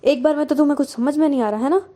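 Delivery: loud, fast burst of reproach; angry scolding. A narrator's reading. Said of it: "पूरा गुस्सा सारा झाड़ देती है". (गुस्से भरी तेज़ डाँट)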